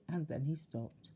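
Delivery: plain reading voice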